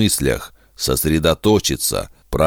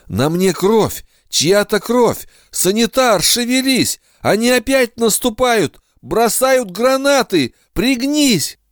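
high panicked battlefield yell; frantic shout. Acted. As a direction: scream in horror. Bloodied soldier, frantic flashback.

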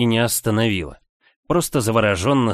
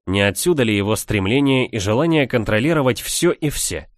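plain reading voice

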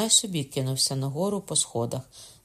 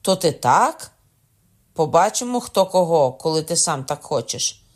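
furious threat; 3 semitones higher, 8 dB louder than usual. A narrator's reading. Wounded Kokona threatening.

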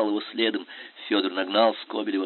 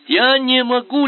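high, loud panicked plea; lieutenant too scared to fire at his general; frightened babble; 14 semitones higher, 8 dB louder, slower than usual. A narrator's reading. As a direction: babble in fear. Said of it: "лепетал поручик".

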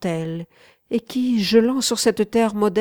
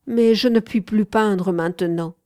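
ordinary delivery, nothing marked

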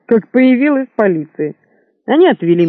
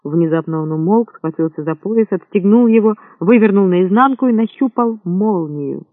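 ordinary delivery, nothing marked

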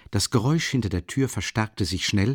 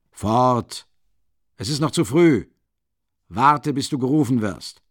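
louder, commanding send-off; sharp order; 3 semitones higher, 5 dB louder than usual. A narrator's reading. Porfiri sends Nikolai back out, commanding. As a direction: drive him out firmly.

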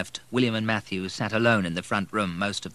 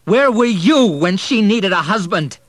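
high, loud accusing yell; furious shout; 12 semitones higher, 12 dB louder than usual. A narrator's reading. Son shouting at his drunk stepfather, furious high yell. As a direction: hurl it at him, self-control snapped. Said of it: "he shouted".

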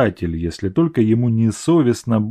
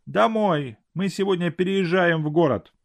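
put-on a higher, coaxing old father; 7 semitones higher, 3 dB quieter than usual. A narrator's reading. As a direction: gently urge your daughter home. Father voiced in story.

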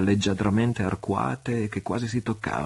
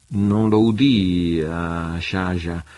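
slow, guarded reply; 6 dB louder, much slower than usual. A narrator's reading. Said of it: "rispose Sandokan".

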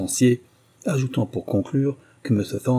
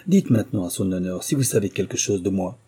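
ordinary delivery, nothing marked

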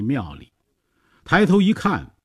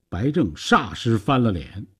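ordinary delivery, nothing marked

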